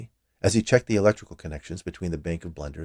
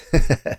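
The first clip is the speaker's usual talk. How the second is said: louder amused laugh; 7 semitones higher, 8 dB louder than usual. A narrator's reading. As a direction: laugh at the joke. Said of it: "He laughed again".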